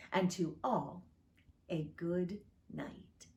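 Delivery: lively, sing-song storytelling read-aloud; reading a poem to children. Acted. Pastor reading Christmas poem to children online, lively storytelling. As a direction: read it like a bedtime story for little ones.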